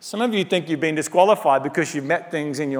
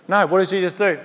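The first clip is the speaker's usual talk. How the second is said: normal talking voice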